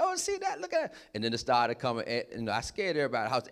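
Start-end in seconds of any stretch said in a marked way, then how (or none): 0.0-1.0 very high, thrilled squeal of wonder; excited exclaiming. Retold or imitated. Joker points out the lightning he called, excited exclaiming. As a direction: show off the storm, voice way up. Thrilled at storm answering him.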